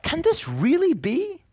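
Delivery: mock listener's stunned question; amazed doubt. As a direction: ask it like you can't believe your ears. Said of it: "you hear this and you go".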